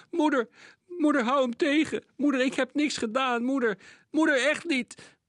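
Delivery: high, loud, desperate begging; panicked pleading boy. A narrator's reading. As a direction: plead for his mother in terror. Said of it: "gilde de jongen". (angstig smekende jongen)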